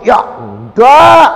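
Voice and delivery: loud, high, drawn-out no; loud refusal. Retold or imitated. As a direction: blurt the refusal out loud and slow. Refusing God, retold outburst.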